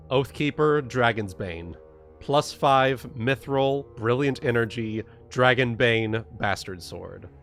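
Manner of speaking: ordinary talk, nothing marked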